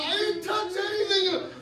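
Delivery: high-pitched plea of innocence; panicked protest. Retold or imitated. Patient caught under the lamp by the nurse, panicked protest. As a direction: blurt it defensively, pitched right up.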